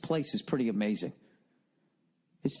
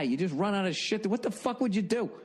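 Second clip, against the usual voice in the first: ordinary talk, nothing marked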